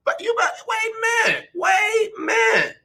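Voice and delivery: high squeaky wait a minute; mock alarm. Host mock-shocked at a rival fan, high squeal.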